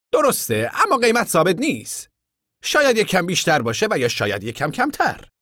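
a high voice for the stranger; high contractor voice. A narrator's reading. Contractor asking the camp men about work, high voice.

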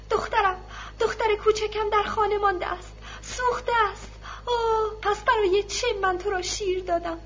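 high, pleading sobbing lament; tearful wailing. A narrator's reading. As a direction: plead through tears like a desperate mother. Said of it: "در میان گریه و شیون گفت".

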